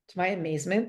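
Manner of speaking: ordinary delivery, nothing marked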